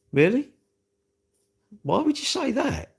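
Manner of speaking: высоко, с изумлением спрашивает; baffled, pitched right up; incredulous. Real, not acted. Incredulous at viewer request.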